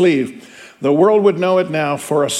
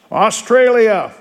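calling the nation out higher, slow; roll call announcer; 6 semitones higher, 4 dB louder, slower than usual. Acted. Teacher reading the roll call to students, called out higher. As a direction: announce the country to the hall.

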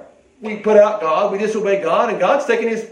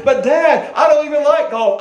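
protest; 7 semitones higher, 3 dB louder than usual. Retold or imitated. Voices son protesting to father.

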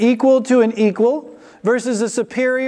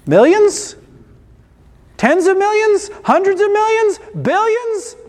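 each guess pitched higher and higher, from keen to astonished; building incredulous guesses; 10 semitones higher, 6 dB louder than usual. Pretend. Preacher teasing the congregation toward the huge debt, building amazement. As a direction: climb in disbelief with every bigger number.

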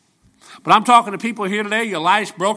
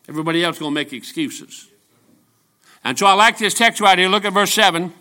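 usual speaking voice

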